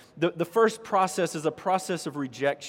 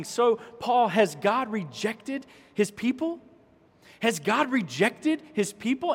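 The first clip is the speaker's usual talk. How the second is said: higher-pitched, insistent question, rising at the end; rhetorical questioning; 6 semitones higher than usual. Retold or imitated. Everyone puts the question to Paul, rhetorical questioning. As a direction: voice the crowd's challenge, lifting into each question. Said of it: "asking this rhetorical question that everyone is asking".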